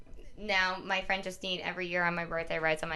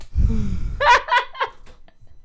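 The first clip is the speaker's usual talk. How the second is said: big laugh; 11 dB louder than usual. Real, not acted. Laughing at the kid story.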